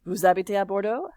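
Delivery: sharp lift at the end of Bordeaux; rising question intonation. Shown as a demonstration. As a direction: send the voice up on Bordeaux. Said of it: "it goes up at the end".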